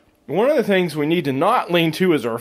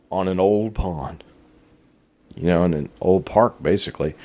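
whispered; 8 semitones lower than usual. Real, not acted. Soft aside.

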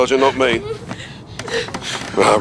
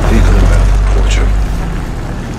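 called warning; 6 dB louder, slower than usual. Acted. Soldier calls out approaching car.

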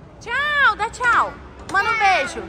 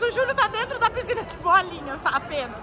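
ordinary talk, nothing marked

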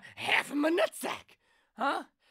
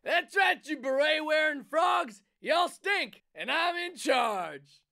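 mock rant; 3 semitones higher, 4 dB louder, slower than usual. Ranting as Super President character.

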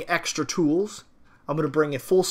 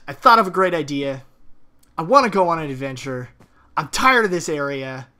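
ordinary talk

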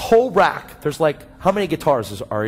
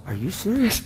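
mock disbelieving question; incredulous; 6 semitones higher than usual. Mother reacts to surprise offer.

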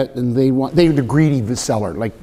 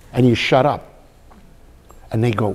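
ordinary delivery

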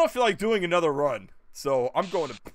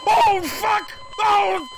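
loud, high yell of pain; startled shout; 7 dB louder, much slower than usual. Real, not acted. Streamer caught out in the game, real loud shout. Cries out during game mishap.

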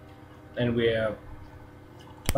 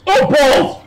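frustrated shout; 13 semitones higher, 9 dB louder than usual. Real, not acted. Fumbling the game controls.

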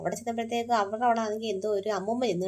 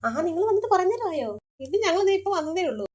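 higher, louder, slow and delighted; happy hostess welcome; excited greeting; 7 semitones higher, 5 dB louder than usual. Acted. Host greets arriving guests.

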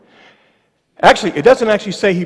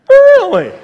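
squeaky, much higher incredulous "Really?"; mock disbelief; 9 dB louder than usual. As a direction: pitch "Really?" way up in comic disbelief. Preacher mocks leaders' demand for sign.

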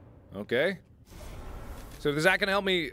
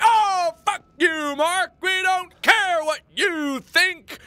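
high, loud, drawn-out yelling; hammed-up screaming heckler; mock abusive viewers; 7 dB louder, much slower than usual. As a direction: hurl it like a furious commenter. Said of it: "then you guys are like".

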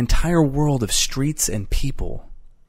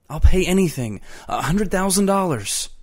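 high, raised-voice plea; desperate pleading; 8 semitones higher, 4 dB louder than usual. A narrator's reading. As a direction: bargain for your life. Eccles begs to buy his way out.

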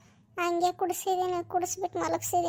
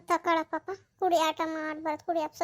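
ordinary talk, nothing marked

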